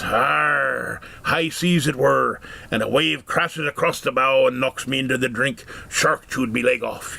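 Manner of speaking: slow gruff pirate growl, arr. Acted. Pirate answering the curious dad, slow and growly. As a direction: play the salty pirate, drawn out. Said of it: "Guy looks at him and says".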